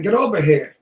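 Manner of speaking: Brooklyn demo, dropped R in "here"; Brooklyn accent. Shown as a demonstration. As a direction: say "here" as "heah", Brooklyn style. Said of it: "teach me how to speak like you".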